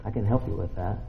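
low, soft gentle offer; small quiet voice. Acted. God offering help to someone busy, small quiet voice. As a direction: offer it quietly, like a little knock. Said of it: "that little small knock on the door".

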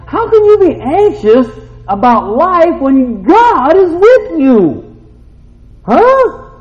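high, loud preaching shout; full-force preaching. Real, not acted. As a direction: preach it at the top of your voice.

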